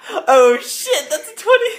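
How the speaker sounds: high, loud laughing exclamation; real shock at a lucky roll; astonished. Real, not acted. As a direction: burst out in delighted disbelief. Game master reacts to top roll.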